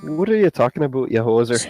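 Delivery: put-on Canadian accent. Mock Canadian insult.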